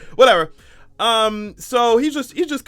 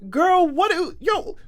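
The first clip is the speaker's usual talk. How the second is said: incredulous call-out; 9 semitones higher than usual. Real, not acted. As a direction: scold them in rising disbelief.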